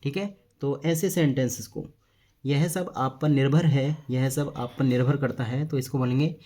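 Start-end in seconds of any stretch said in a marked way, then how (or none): none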